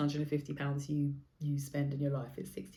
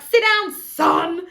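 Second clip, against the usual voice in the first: booming order to sit down; loud command; 12 semitones higher, 14 dB louder, much slower than usual. Retold or imitated. Pulling out his chair, loudly ordering her date to sit. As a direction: bark it out loud across the table. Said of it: "really loudly".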